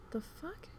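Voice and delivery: high, hushed retold bewilderment; baffled disbelief. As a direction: start small and high, puzzled and incredulous. Retold reaction to voice switching back.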